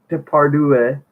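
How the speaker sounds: ordinary delivery, nothing marked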